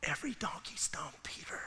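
soft unvoiced whisper to Peter. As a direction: whisper it like a private joke. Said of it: "I read Peter and I'm going".

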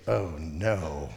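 low, soft "oh, no" to himself; retold inward groan of dismay; sinking dread. Retold dread on seeing her fury.

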